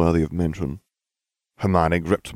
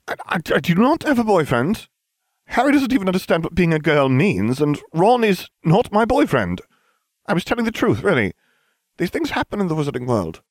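sputtering; 12 semitones higher than usual. A narrator's reading. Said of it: "Hermione blushed, sputtering".

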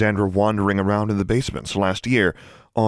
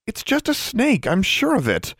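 high, stubborn protest; stubborn insistence; 14 semitones higher than usual. A narrator's reading. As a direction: insist on it, pitched up. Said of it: "Alexandra insisted stubbornly".

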